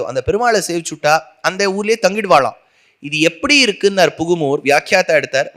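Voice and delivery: normal talking voice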